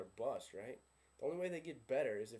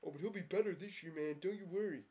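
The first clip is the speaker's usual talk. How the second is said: mock Dolphins fan; 6 semitones higher, 4 dB louder than usual. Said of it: "you're like".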